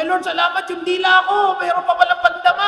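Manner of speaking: high, loud joyful cry to the Lord; overjoyed thanks. Retold or imitated. Blind man thanking the Lord for his tongue, overjoyed. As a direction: thank God with bursting joy. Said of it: "Tuwan-tuwa siya".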